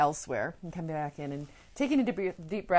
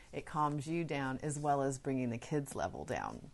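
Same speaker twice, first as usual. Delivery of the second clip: usual speaking voice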